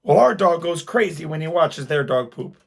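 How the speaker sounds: lightly voiced complaining wife; mock indignant wife. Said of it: "she's just mad".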